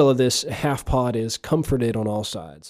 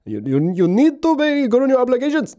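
high-pitched mock foreign accent of a bill collector; hammed-up accent, chasing a debtor; [name]'s put-on accent; 11 semitones higher than usual. Bill collector calling day and night about overdue bills, comic put-on accent. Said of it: "I just wanted to do that accent".

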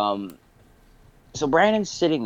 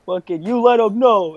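much higher, egging her on like a hype man; mock hype cheering; 8 semitones higher than usual. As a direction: cheer her on with fake enthusiasm, pitched way up. Mock egging on drunk girl.

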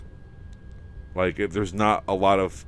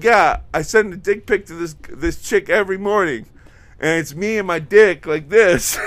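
mock bro voice; 9 semitones higher, 6 dB louder than usual. Voicing a show character bragging.

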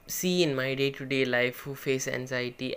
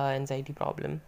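usual speaking voice

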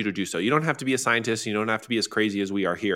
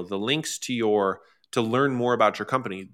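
ordinary talk

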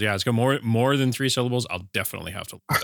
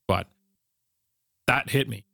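usual speaking voice

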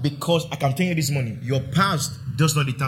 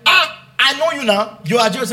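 put-on accusing girl; 8 semitones higher, 4 dB louder than usual. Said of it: "one small girl said".

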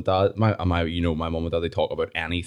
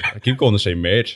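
ordinary delivery

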